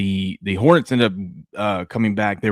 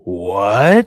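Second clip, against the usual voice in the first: mock-stunned, loud rising question; astonished what; 7 dB louder than usual. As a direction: blurt it out in mild mock disbelief. Retold astonishment at wife's offer.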